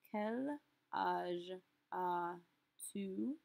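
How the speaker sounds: "Quel âge as-tu?" stretched out syllable by syllable; very slow, drawn out. Shown as a demonstration. Teacher drawing the question out very slowly for students. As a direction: drag every syllable out. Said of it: "ask this in a second way".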